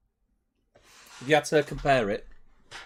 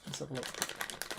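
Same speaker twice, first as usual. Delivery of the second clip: whisper; 11 dB quieter, much slower than usual. Real, not acted. Leaning in to inspect cereal.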